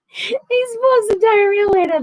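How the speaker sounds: high-pitched laughing exclaim; high amused squeal. Real, not acted. Finishing Ed's gross joke.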